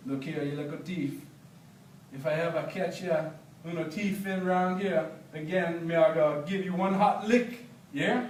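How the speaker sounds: lightly voiced Jamaican scolding; a hint of a stern Biga threatening a young thief.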